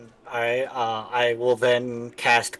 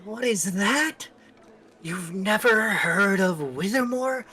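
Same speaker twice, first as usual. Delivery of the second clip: surprised question; 7 semitones higher than usual. Acted. Character asks the stranger in disbelief, high and surprised. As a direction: sound amazed he hasn't heard of it.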